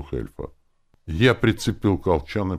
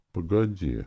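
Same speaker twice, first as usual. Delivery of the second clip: ordinary delivery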